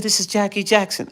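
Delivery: very high Michael-like voice; [name]. Retold or imitated. Caller giving his full name, high imitation. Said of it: "this is just how he sounded".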